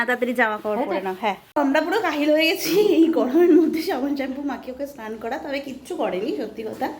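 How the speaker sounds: ordinary delivery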